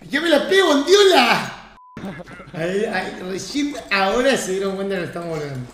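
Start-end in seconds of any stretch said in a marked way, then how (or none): 0.1-1.6 high, loud hyped yelling; excited shout. Real, not acted. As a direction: cheer a teammate's big play. Hyped moment in match. (grito eufórico)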